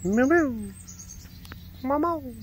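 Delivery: high-pitched cat call; squeaky pretend meow; playful meowing. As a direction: mimic a cat calling out.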